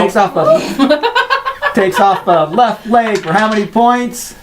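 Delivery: laughing through the words; can't stop laughing while narrating; giggling mid-sentence. Real, not acted.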